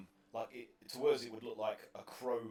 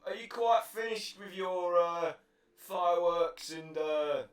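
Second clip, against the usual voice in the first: raised, louder NPC voice; a brisk guard calling out; 8 semitones higher, 14 dB louder than usual. Acted. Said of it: "he's like".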